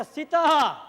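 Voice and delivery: loud falling call of a name; loud stage call. Acted. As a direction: bellow the name across the stage. Ram's stage cue calling Sita. (નાટકમાં રામ સીતાને જોરથી બોલાવે છે)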